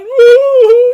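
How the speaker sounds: loud, very high hammed-up sob; mock sobbing. Rabbi imitating the sage breaking into tears, mock sob. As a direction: howl a comic theatrical boo-hoo. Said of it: "He starts crying".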